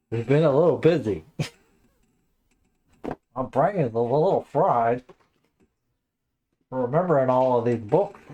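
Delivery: goofy, swooping sing-song voice; silly put-on voice. Host joking to viewers about a fried brain, silly put-on voice. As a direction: play up a frazzled brain in a silly voice. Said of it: "I don't know why I'm talking like this".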